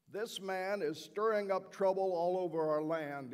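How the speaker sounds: loud, angry crowd accusation; furious accusing shout; yelling accusation. Retold or imitated. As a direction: shout him down to the governor. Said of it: "They continued yelling at me".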